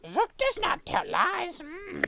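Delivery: high, slow squeaky gremlin. Acted. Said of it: "Zook has a very gremlin voice".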